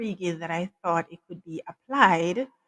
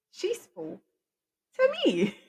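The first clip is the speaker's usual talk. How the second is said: high-pitched, breaking into a chuckle; amused high lilt with a giggle after; playful, amused; 10 semitones higher than usual. Real, not acted. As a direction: say it high and tickled, laughing at the end. Playful punchline ending in laugh.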